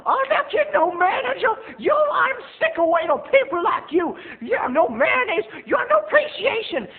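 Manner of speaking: very high, loud ranting; sassy clerk. Retold or imitated. Clerk ranting at mom, very high loud sass. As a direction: rant like a fed-up diva. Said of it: "And he goes".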